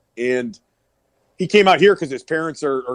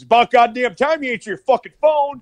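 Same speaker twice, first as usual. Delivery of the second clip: higher and louder mock scold; gruff Steve Austin; 8 semitones higher, 5 dB louder than usual. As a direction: chew him out for not answering. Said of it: "And he goes".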